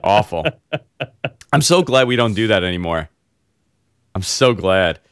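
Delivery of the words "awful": usual speaking voice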